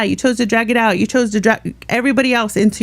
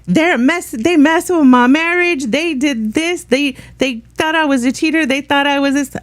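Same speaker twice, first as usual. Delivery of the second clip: mocking Savage; 5 semitones higher than usual. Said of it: "the clip of Savage is like".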